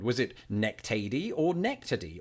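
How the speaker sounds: ordinary talk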